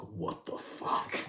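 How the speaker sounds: slow, breathless whisper; stunned, half-whispered; whispered what the fuck. Retold or imitated. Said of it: "and I was like".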